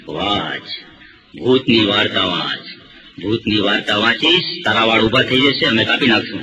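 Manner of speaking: deep, slow spooky voice; low slow ghostly threat to the customer; ghost barber voice. Retold or imitated. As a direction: growl it like a ghost tormenting a customer.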